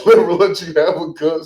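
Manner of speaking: ordinary talk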